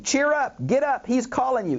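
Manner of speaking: high-pitched acted encouragement; encouraging crowd. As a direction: urge him up in a lifted, eager voice. Crowd turns encouraging to beggar.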